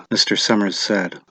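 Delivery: usual speaking voice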